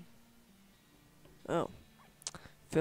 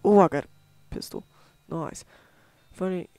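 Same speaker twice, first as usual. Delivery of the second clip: normal talking voice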